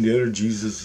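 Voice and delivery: usual speaking voice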